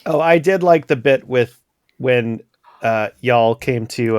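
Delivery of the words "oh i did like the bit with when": ordinary talk, nothing marked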